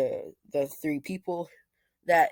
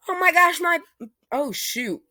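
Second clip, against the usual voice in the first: much higher and louder, flustered gasp; frantic mother; 12 semitones higher, 7 dB louder than usual. Acted. Mother rushing in to see her son, frantic and high. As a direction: burst in, overwhelmed at seeing him.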